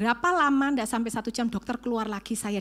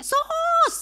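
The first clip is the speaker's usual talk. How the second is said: high, stretched shout to the nurse; yelling for help; 4 dB louder than usual. Retold or imitated. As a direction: call out shrilly in a panic. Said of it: "saya teriak".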